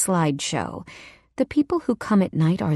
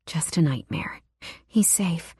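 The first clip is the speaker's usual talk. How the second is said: soft relieved whisper; 5 dB quieter than usual. Acted. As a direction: breathe it out in relief. Relief after waking.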